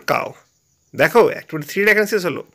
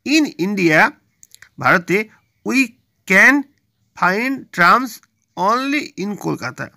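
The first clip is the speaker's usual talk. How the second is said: slow reading; much slower than usual. Shown as a demonstration. Teacher reading exercise sentence.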